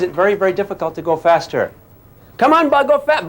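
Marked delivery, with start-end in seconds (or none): 2.4-3.3 higher, pushy cheering-on; urging the bug. Acted. Teacher voicing someone egging the bug on, higher. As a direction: egg the bug on.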